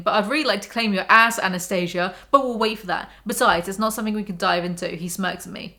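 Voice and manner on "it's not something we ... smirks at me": ordinary talk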